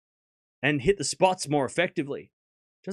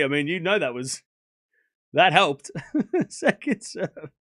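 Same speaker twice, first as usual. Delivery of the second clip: ordinary talk, nothing marked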